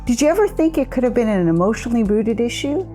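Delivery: usual speaking voice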